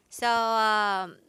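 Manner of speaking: ordinary talk, nothing marked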